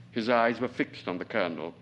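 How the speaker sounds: usual speaking voice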